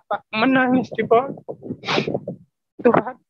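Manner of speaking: high, emotion-laden voice, halting pauses; tearful testimony. Real, not acted. Man recalls weeping to God over his marriage, tearfully. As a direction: struggle through it, close to tears.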